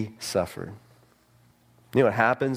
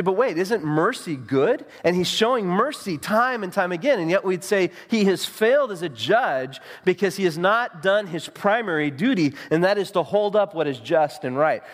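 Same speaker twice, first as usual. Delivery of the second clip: impassioned preaching; 8 semitones higher than usual. Acted. Preaching at full force.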